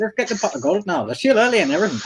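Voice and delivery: high, hammed-up leprechaun voice; mock Irish leprechaun. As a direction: parody a chirping Irish leprechaun. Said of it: "I'm a fucking leprechaun".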